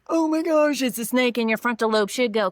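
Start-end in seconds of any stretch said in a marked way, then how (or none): 0.1-1.4 high, loud startled gasp at a snake; mock panic. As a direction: play the panicked brain spotting a snake. Thalamus voiced panicking over snake.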